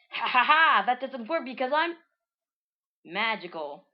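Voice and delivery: loud, high mock villain cackle into a boast; high, loud hammed-up gloat; gloating laugh. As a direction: laugh it off smugly. Villain gloats after crotch kick.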